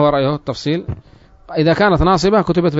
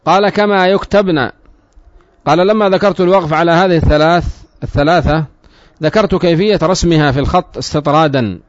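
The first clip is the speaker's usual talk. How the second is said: loud, measured classical Arabic reading; formal text reading; 3 semitones higher, 5 dB louder than usual. Acted. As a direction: read the book out firmly to the class. Reads the book text aloud.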